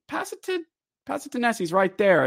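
high, pleading call to pass; urgent urging. Retold or imitated. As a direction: shout advice at the player. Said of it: "I'm like".

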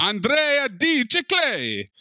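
high, slow drawn-out name; hammed-up announcement; mock announcer. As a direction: stretch the name for laughs. Mocking name pun.

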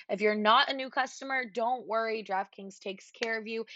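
normal talking voice

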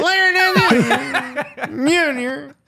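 slow drawn-out Southern drawl; Southern accent. Pretend. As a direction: stretch the name like a race car passing. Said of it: "sounds like the car going around the track".

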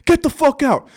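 high, loud hammed-up yelling; mock furious husband. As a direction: bellow it like the angry husband at the window. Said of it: "And he's like".

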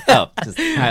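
high, laughing words. Real, not acted. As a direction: talk through laughter. Laughing through robot bit.

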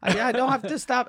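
high, fast, flustered reassurance; nervous driver. Retold or imitated. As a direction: rattle it off like a panicking driver. Driver insisting he knows.

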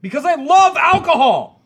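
high, loud, mock-proud cry; loud hammed-up confession; jokey shout. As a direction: belt it out as a boast. Joking shout about drinking.

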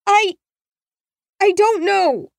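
put-on high, hesitant child's voice; lost frightened girl. A narrator's reading. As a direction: stammer it out, unsure and scared. Trapped girl answers, lost.

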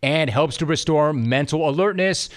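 upbeat, steady sponsor pitch; ad read. Acted. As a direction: sell it like a radio spot. Host reads sponsor ad.

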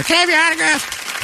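very high mock fan voice; put-on high-pitched autograph request; eager autograph seeker. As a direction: squeal it like a pestering fan. Acting out pestering autograph seeker.

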